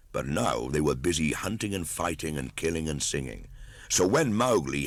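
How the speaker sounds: ordinary delivery, nothing marked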